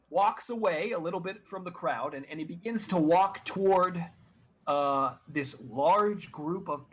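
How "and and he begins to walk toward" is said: normal talking voice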